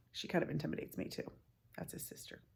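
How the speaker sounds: fast unvoiced whisper; whispered aside. Real, not acted. Reader whispering an aside to the children.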